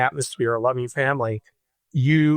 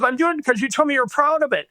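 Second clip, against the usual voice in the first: mocking mother; 12 semitones higher, faster than usual. Mother retorts on front lawn.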